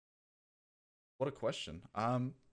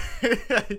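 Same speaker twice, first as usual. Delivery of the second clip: high burst of laughter; 14 semitones higher, 9 dB louder than usual. Real, not acted. Laughs at chat insult.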